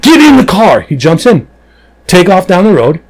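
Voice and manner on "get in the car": loud and high, rapid-fire get in; urgent shouted order. Retold or imitated. Cop urging the ride-along guy into the car, urgent shout. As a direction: bark it like there's a fire under the car.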